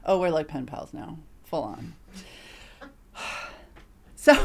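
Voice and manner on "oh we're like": ordinary talk, nothing marked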